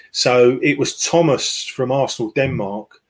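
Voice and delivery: ordinary talk, nothing marked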